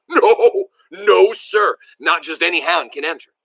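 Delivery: high, loud burst of refusal; jumpy emphatic answer. A narrator's reading. Grandpa correcting Billy about the hunt, excited outburst. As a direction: leap in with a startled denial. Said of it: "almost jumped as he answered".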